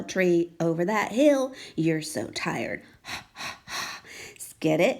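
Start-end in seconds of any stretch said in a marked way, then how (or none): 1.8-2.8 weary sigh. Shown as a demonstration. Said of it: "How we remember that sound". 3.1-4.0 a long weary sigh out; tired breathy h sigh. Shown as a demonstration. Teacher shows the tired h sound, a long sigh. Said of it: "How we remember that sound".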